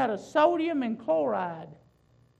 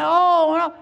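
loud, higher mock moan; mock groan; 5 semitones higher, 10 dB louder than usual. Preacher mimics someone suffering under the fire, loud mock moaning. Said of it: "groan and moan and say".

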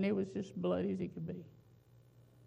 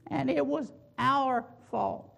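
louder, insistent refrain, "our" hit every time; building preaching; 3 semitones higher, 5 dB louder than usual. Acted. Preacher drives home the congregation's guilt in a rising refrain. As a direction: preach each line harder, pounding "our".

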